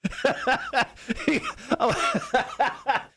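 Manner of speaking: high laughing retold reply. Host retells his confused answer to the coach, laughing. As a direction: squeal it high through laughter. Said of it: "I'm like".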